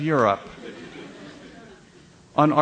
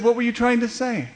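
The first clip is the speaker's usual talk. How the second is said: pitched way up, disbelieving; imitating a baffled demand; incredulous publicist; 9 semitones higher, faster than usual. Publicist asking the comedian what he meant, incredulous. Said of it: "she said".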